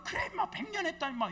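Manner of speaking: high, loud, furious comeback; hot-tempered shout. Retold or imitated. Number two pastor firing back at the old pastor, shouting. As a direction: blurt it back in a flash of temper. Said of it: "막 발끈하더니".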